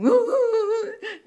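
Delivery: very high, loud mock sobbing; comic high-pitched bawling; imitated wailing. Mother bursts out crying mid-prayer, mock wail. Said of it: "Dia bukannya doa, dia menangis".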